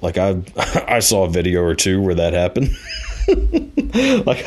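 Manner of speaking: laughing into "Like". Real, not acted. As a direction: crack up mid-sentence. Laughs at his own confession.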